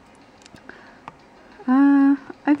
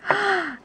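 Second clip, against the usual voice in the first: sharp gasp; 10 semitones higher than usual. Real, not acted. Sees a shiny Pokemon.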